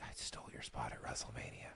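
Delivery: retold whisper, very soft and breathless. The Rock whispering to Cody during the bro hug, a low secret whisper. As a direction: hush it like a secret in his ear. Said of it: "whispers to Cody".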